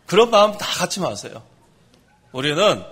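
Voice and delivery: ordinary delivery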